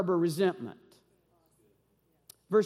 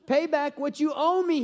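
raised, higher demand for payment; demanding servant; 6 semitones higher, 4 dB louder than usual. Acted. Servant demanding his debt, forceful higher call. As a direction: bark the debt back at him. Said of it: "he demanded".

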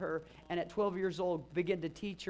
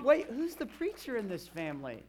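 mock indignant; 6 semitones higher, 5 dB louder than usual. Joking to his mom about cousin.

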